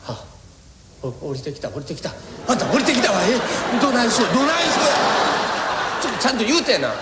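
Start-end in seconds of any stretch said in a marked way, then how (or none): 2.5-6.9 fluster as the son comes downstairs; flustered wife. Acted. Wife panics as the son comes downstairs, higher and laughing.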